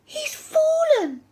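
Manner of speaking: high, wailing alarm; mock panic. A narrator's reading. Henry pretending his brother slips from the window, panicked wail. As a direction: cry out as if losing your grip.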